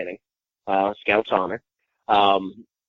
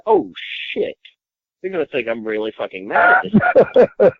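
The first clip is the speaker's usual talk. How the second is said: much higher, panicked realization; retold alarm; 10 semitones higher, 5 dB louder than usual. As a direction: shoot up high as it dawns on you. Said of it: "I said".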